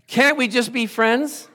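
high, loud, slow mock woman's voice; woman's brush-off. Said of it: "the woman says".

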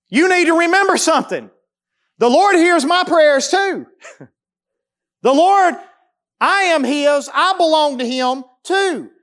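high, loud preaching push; fired-up preacher proclaiming; preaching at full force. Real, not acted. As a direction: drive it home like a pulpit climax. Preacher raises voice on the Lord.

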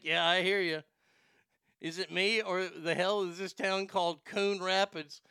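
normal talking voice

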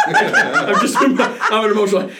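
laughing through tears; real emotional laugh, breaking into laughter. Real, not acted. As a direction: laugh it off while choked up. Choked up, laughing at himself.

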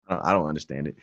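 ordinary talk

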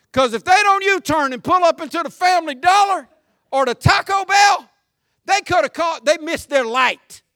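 shouting about traffic; exasperated shout. Real, not acted. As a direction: vent at the road's morons. Ranting about drivers' pointless U-turns.

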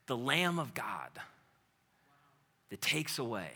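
soft, low reverent reading; hushed scripture. Acted. Preacher reading scripture softly.

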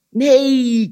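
loud, high, drawn-out frog refusal; croaking frog. A narrator's reading. Frog refuses Anansi's walk, loud croaking cry. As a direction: croak out a stretched, emphatic no. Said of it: "kwaakte de kikker".

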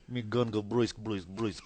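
mock Russian gangster voice; lightly hammed-up Russian tough guy; heavy Russian accent menace. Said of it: "Me gun go".

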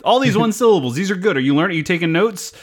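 high-pitched parody of an eager teacher; mock teacher. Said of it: "let the teachers take this one".

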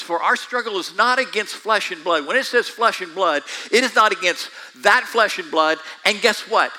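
loud, high preaching; preaching at full force. Acted. Preacher building to full force over flesh and blood, loud. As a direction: proclaim it to the congregation.

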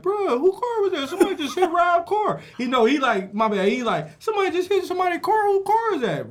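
a young co-worker alerting the shop, voiced high and urgent; excited alarm. Co-worker alerts about hit car.

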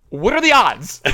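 high, loud burst of disbelief; amazed outburst. Real, not acted. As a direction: exclaim it loudly, astonished at the coincidence. Amazed exclamation at the coincidence.

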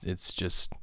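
usual speaking voice

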